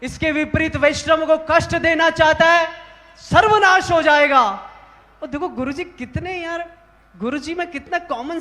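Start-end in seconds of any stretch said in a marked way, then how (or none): 0.0-4.4 raised, forceful voice of a scolding guru; imitated angry outburst; guru's angry scolding. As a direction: rebuke them loudly, building to a curse. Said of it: "अब देखो गुरुजी का गुस्सा देखो आप".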